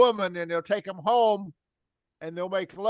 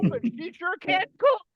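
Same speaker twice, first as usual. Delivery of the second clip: mocking high call; 12 semitones higher than usual. Taunt continues after interruption.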